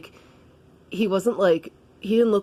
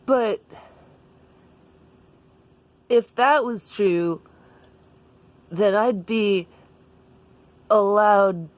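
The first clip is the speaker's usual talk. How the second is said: hesitant, slightly raised character voice; Daniel; 3 semitones higher, slower than usual. Acted. Daniel tests the idea on Jessica, haltingly in a raised voice. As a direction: work it out haltingly, with long pauses. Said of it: "And Daniel was like".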